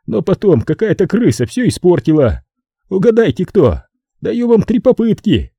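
high-pitched put-upon man; high, agitated character voice; distraught Brogan. Acted.

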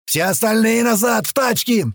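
high, urgent shouted command; yelled order. A narrator's reading. Туман sending the others back to cars, yelling. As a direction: holler it out to the whole crew. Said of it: "продолжал орать Туман".

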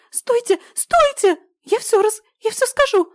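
very high shrieking plea; hysterical squeal. Acted. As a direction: scream for them to stop. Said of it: "истерично завизжал Блау".

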